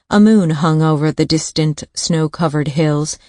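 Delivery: usual speaking voice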